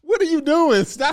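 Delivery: high, loud comic outcry; mock alarm. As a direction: cut her off in exaggerated disbelief. Acted reaction to the host voice.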